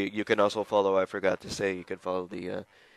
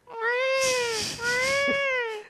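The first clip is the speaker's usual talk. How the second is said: startled laugh. Real, not acted.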